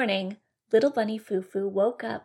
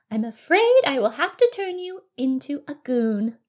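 higher-pitched fairy warning; 6 semitones higher, 4 dB louder than usual. Acted. Fairy giving bunny one last chance, high fairy voice. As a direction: warn firmly in a fairy voice. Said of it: "said with a fairy-like voice".

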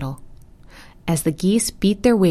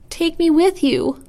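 high, urgent plea as the turtle; pleading cry; 12 semitones higher than usual. A narrator's reading. Turtle begs geese to take him along, pleading cry. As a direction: cry it out, much higher, begging to come. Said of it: "cried the turtle".

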